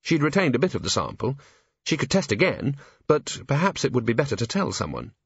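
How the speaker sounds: ordinary delivery, nothing marked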